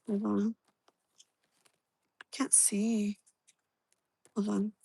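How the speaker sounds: breathy whisper in the dark; hushed, unvoiced aside. Real, not acted. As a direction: whisper it, can barely see. Fumbling with cards in the dark.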